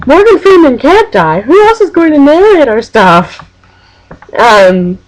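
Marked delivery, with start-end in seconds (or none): 0.1-3.4 high-pitched mock dismay; imitated outcry. Friend protests a film character's death, mock outraged. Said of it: "David goes".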